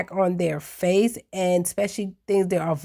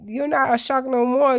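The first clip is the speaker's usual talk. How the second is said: ordinary talk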